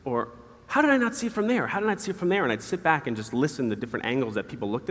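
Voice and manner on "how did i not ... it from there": high-pitched, incredulous self-questioning; retold self-astonishment. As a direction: marvel at your own blind spot, pitched way up. Retold amazement at own blind spot.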